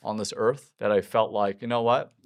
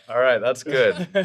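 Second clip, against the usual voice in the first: usual speaking voice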